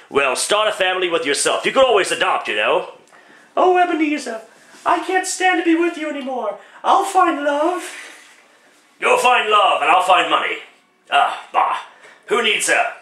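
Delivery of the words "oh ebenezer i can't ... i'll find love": put-on much higher young woman's voice; falsetto girlfriend. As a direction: plead as the girlfriend in a high voice. Girlfriend leaves Scrooge.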